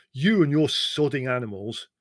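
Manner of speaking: loud, exasperated grumble; annoyed outburst. Acted. Leslie, fed up with his brother's pets: loud exasperated grumble. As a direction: throw it out, fed up with the animals.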